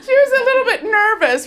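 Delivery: high, loud, laughing. Real, not acted. Telling about the dean's worry, laughing high and loud. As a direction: laugh through the words.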